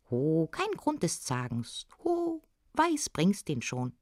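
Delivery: drawn-out "Ho" in a goblin voice; little smith calling out slowly. Acted. Little smith answers the farmer.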